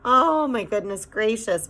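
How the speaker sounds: Homily's despairing cry; moaning. A narrator's reading. Homily moaning at having to leave tonight. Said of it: "moaned Homily again".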